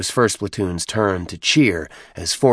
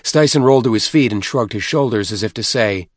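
usual speaking voice

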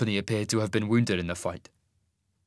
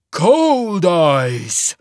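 loud, raised, booming exclamation; surprised rumbling giant; 7 semitones higher, 9 dB louder than usual. A narrator's reading. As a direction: boom the name out in surprise. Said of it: "he rumbled, sounding surprised".

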